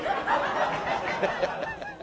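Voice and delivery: a real high giggle with no voice behind it; high breathless laugh. Real, not acted. Preacher laughing at his own crawfish joke, breathless giggle. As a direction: let a silent, high laugh burst out.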